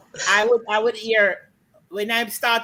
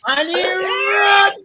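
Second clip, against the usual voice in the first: high, loud laughing cry; laughing shout; 10 semitones higher, 6 dB louder, much slower than usual. Real, not acted. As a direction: shriek with laughter at the punchline. Punchline of ghost story.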